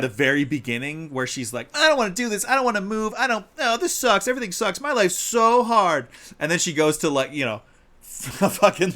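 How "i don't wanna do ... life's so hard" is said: high, louder mock whine of a sulky kid; whiny Chihiro. Chihiro at the start refusing to move, whining. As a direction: complain like a spoiled child.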